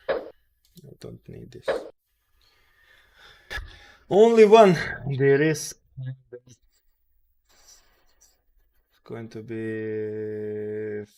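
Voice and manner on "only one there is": loud outburst. Real, not acted. Sudden outburst at chart.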